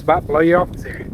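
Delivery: normal talking voice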